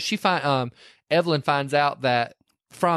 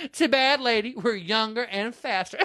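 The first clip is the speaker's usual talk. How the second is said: taunting; 7 semitones higher than usual. Retold or imitated. Young women taunt.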